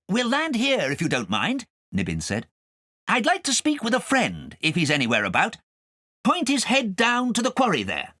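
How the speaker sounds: high, light cat voice; cat's voice. A narrator's reading. Nibbins telling Kay where to land, high and light. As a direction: speak as a friendly cat.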